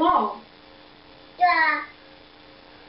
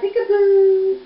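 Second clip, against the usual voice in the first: high, loud, stretched singsong peekaboo; playful peekaboo; 5 semitones higher, 5 dB louder, slower than usual. Pretend. Caregiver playing peekaboo with baby, playful. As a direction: draw it out high and bright for a baby.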